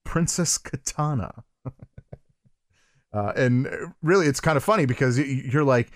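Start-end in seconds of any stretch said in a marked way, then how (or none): 0.0-1.4 slow, hushed reveal after a pregnant pause; drawn-out ominous name drop; dramatic reveal. Shown as a demonstration. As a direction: let "Princess Katana." land slowly. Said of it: "Pregnant pause".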